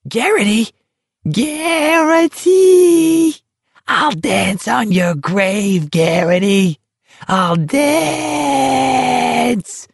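very high, drawn-out crazed shrieking; mad cackling taunt. Acted. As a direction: shriek it like a laughing madman. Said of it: "Barkovich was cackling, higher and madder".

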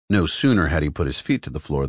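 ordinary delivery, nothing marked

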